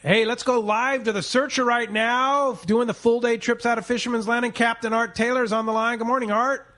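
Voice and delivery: upbeat, measured live radio handoff; radio announcer. Acted. Radio host hands off to captain.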